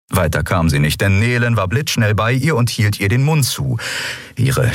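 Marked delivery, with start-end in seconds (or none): none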